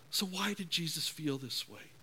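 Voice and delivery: usual speaking voice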